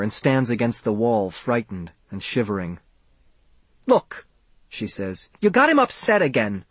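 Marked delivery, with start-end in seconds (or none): 3.9-6.6 acted quarrel. Acted quarrel between the parents.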